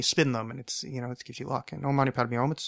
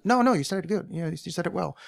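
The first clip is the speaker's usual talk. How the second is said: higher-pitched, poor attempt at a Tibetan accent; lightly put-on, inexpert Tibetan accent; mimicked Tibetan accent; 5 semitones higher than usual. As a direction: badly imitate a shopkeeper's Tibetan accent. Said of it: "I don't know why I'm doing an accent".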